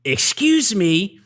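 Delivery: high, very slow and grand; Brickma voice. Retold or imitated. Retelling Brickma clearing girls aside in a slow voice. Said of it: "he goes".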